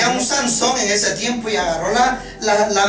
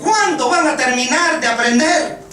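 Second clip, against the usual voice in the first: grito de reclamo, agudo y fuerte; regaña a gritos como maestro harto; regaño exasperado; 7 semitones higher, 5 dB louder than usual. Retold or imitated. Regaño actuado de Jesús a discípulos. (imitando enojo, con la voz muy alta)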